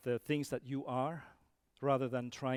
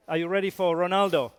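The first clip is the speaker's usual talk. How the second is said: loud, raised call to the crowd; rousing shout; 5 semitones higher, 14 dB louder than usual. Real, not acted. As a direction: fire up the audience with a big shout. Rousing the audience from stage.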